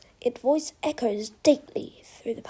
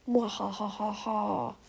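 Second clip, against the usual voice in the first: drawn-out "mwahaha" evil laugh; 2 semitones lower, 5 dB quieter, slower than usual. A narrator's reading. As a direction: gloat with a slow, softer villain's laugh. Villain crow gloats in story.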